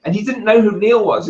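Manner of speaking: ordinary delivery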